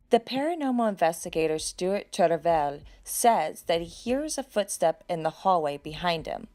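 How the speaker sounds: ordinary talk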